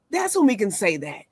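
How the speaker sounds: usual speaking voice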